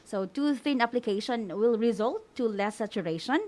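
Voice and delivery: ordinary delivery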